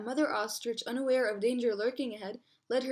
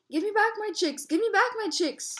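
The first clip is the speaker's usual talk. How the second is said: very high, insistent cry; storybook yell; 8 semitones higher than usual. A narrator's reading. Mother ostrich stamping behind the lioness, high mock yell.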